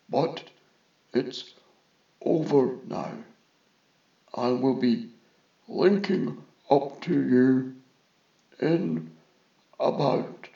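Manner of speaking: slow, halting delivery in short chunks; measured reassurance over a link, pausing often; reassuring vizier. A narrator's reading.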